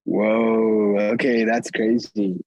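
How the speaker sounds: usual speaking voice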